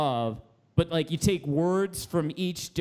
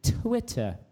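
"Twitter" higher, with an English "Twit-tuh"; English accent; 4 semitones higher than usual. Retold or imitated. Host mimicking English people, English accent on "Twitter". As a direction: imitate English "Twitter", crisp T and no R. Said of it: "like, English people when they say Twitter".

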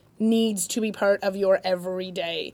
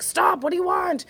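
exasperated parent; 9 semitones higher than usual. Retold or imitated. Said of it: "we're like".

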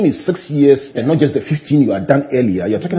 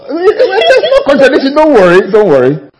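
excited; 14 semitones higher, 10 dB louder than usual. Real, not acted. Reassuring after the old clip comes up.

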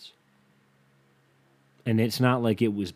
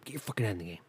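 quieter self pep talk; 11 dB quieter, much faster than usual. Pretend.

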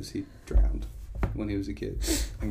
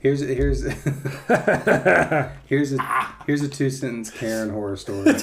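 laughing; 3 semitones higher, 5 dB louder than usual. Real, not acted. Laughing while starting his story.

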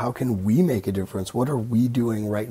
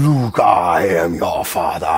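low, slow, louder Vader boom; Vader impression; 6 semitones lower, 6 dB louder than usual. Savoring the Vader line for fun, low and slow. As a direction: relish it, low and booming. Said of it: "I just like saying".

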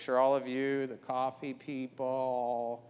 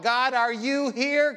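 crying out to God; 8 semitones higher, 6 dB louder than usual. Pretend. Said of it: "sometimes cry out".

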